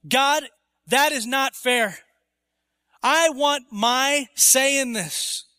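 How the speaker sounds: acted entitled objection, drawn out; upset protest. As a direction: spell out each word as a demand. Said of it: "The question here is upset".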